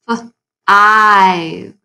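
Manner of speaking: F, then a long stretched ive; segmented five. Shown as a demonstration. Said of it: "Do you hear the I in the middle sound".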